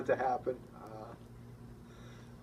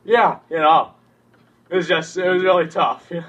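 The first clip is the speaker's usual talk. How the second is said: choked up; 5 semitones higher, 16 dB louder than usual. Acted. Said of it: "I get emotional every time".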